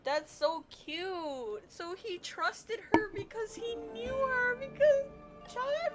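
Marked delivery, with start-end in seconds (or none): none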